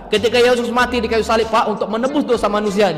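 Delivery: ordinary talk, nothing marked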